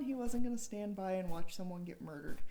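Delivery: ordinary delivery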